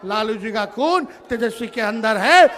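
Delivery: ordinary talk, nothing marked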